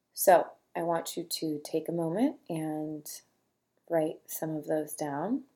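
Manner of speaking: normal talking voice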